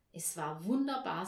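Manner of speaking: plain reading voice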